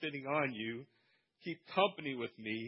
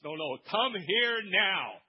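slow, louder insistent call; right way, slow insistent order; firm command; 4 semitones higher, 6 dB louder, much slower than usual. Shown as a demonstration. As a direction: demand it right now.

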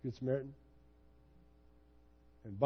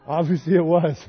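louder, chuckling through the words; laughing at himself; 8 dB louder than usual. Real, not acted. Pastor laughs at his own failed romance, chuckling.

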